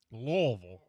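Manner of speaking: "Louisville" said as LUH-vul, no E sound; local pronunciation. Shown as a demonstration. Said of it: "there's no E sound in Louisville".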